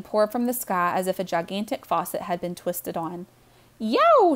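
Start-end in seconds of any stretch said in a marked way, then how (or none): 3.8-4.3 high, loud yelp; sudden high cry of alarm; startled shout. A narrator's reading. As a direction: yelp it as if jolted to your feet. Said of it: "shouted Missus Tresky".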